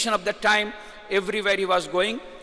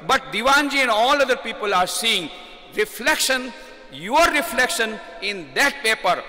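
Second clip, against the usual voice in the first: fervent raised preaching; emphatic shout; 4 semitones higher than usual. Acted. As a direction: proclaim the point to the hall. Climax of the teaching point.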